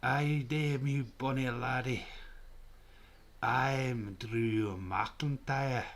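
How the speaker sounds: broad mock Scots; drawn-out stage-Scottish accent. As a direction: put on a hearty Scottish voice. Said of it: "Do a Scottish one".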